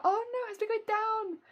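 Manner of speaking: usual speaking voice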